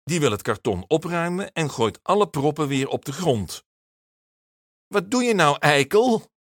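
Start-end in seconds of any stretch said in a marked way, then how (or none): 4.9-6.3 put-on high, loud child's yell; furious schoolgirl outburst; angry shout. A narrator's reading. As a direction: yell at the boy who made a mess. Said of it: "roept Marjolein".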